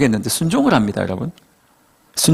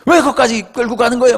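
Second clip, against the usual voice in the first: acted-out annoyed protest, higher voice; mock-irritated objection, pitched up; exasperated inner grumble; 7 semitones higher than usual. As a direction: grumble indignantly as the put-upon fisherman. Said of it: "짜증이 극치였어요".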